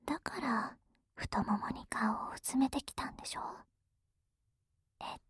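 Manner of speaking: whispering. Acted. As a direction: whisper it gently, up close. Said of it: "ささやいてあげる".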